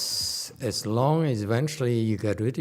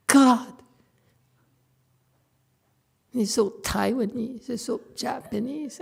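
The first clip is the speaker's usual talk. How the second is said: mock judgmental voice, higher; judgmental person; 7 semitones higher than usual. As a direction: lightly imitate a fault-finding critic. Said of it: "don't you hate it when people talk like that".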